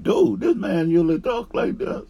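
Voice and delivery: high, loud incredulous question; retold astonishment. New worker asking coworkers about the outburst, astonished. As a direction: replay your shock at the outburst.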